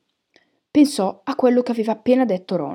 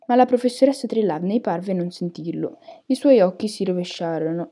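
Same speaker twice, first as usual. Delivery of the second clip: usual speaking voice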